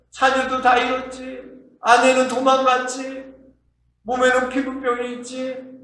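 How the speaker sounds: high, insistent acted complaint; suffering Job's lament. Job counting up his losses, acted anguished lament. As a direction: list the woes in a high, pleading chant.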